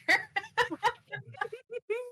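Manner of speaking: high breathy giggle; real laughter. Real, not acted. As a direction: laugh helplessly out of character. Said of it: "I'm laughing out of character".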